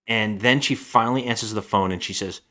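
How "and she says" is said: ordinary delivery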